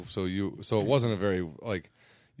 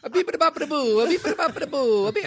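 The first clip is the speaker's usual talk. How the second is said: put-on high, loud Italian-accented nonsense; mock Italian gibberish; 12 semitones higher, 5 dB louder than usual. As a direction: rattle off cartoon Italian babble. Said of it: "don't make him do impressions of Italian people".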